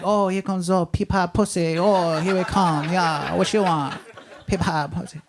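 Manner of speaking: put-on slow, drawn-out caricature Chinese accent; mock Chinese accent. Counter lady mocks the pea pod customer, mock Chinese accent. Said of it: "She's like".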